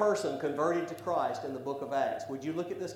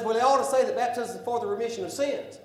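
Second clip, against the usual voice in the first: higher, quicker voice of a skeptic; mock objector; 6 semitones higher, 3 dB louder, faster than usual. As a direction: mimic the doubter's demand. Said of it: "And somebody says".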